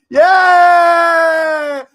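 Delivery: long, high, loud drawn-out yeah; long cheering yell. Real, not acted. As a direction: cheer like in the crowd.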